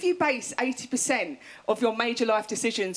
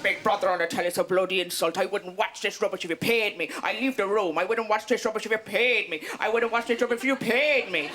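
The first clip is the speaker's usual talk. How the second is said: low-pitched, put-on grumbling nan; nan's grumble; 4 semitones lower than usual. Comedian mimics nan moaning about the television, grumbling. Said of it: "She's like".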